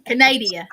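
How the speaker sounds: Canada drawled loud as kuh-NAY-dee-uh; real country. Pretend. Joking answer to where she is from, Canada as country kuh-NAY-dee-uh. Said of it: "I'm gonna say real country".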